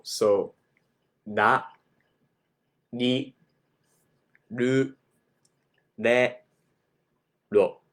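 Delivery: ra ri ru re ro with a tapped r; Japanese r syllables. Shown as a demonstration. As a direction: tap the tongue behind the teeth. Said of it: "make the mouth shape for the vowel".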